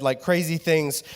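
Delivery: normal talking voice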